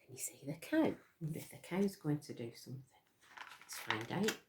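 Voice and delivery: whispered, quick and soft; soft conspiratorial whisper; hushed question. Real, not acted.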